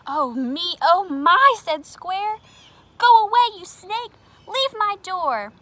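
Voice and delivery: high, loud panicked shrieks; frightened squeal. Acted. Scared Square yelling through the door, high panic. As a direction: shoo the snake away in a panic.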